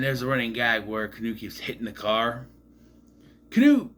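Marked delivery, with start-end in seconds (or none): 3.5-3.9 excited shout. Real, not acted. Host relishing the running gag, loud high call. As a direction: announce the name like a punchline.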